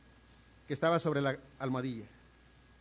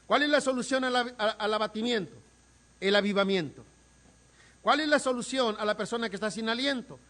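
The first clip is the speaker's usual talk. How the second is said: louder rhetorical preaching questions; preaching at full force; 6 semitones higher, 4 dB louder than usual. Acted. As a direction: drive the questions home to the congregation. Predicación con fuerza. (predicando con fuerza)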